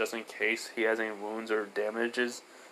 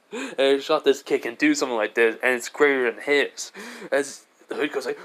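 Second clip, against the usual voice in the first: usual speaking voice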